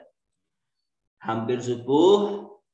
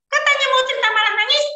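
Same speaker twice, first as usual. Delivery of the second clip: put-on high, curious child's voice; a boy pestering his father with questions; 8 dB louder, much faster than usual. Young Ismail asking his father about the dream in a high child voice.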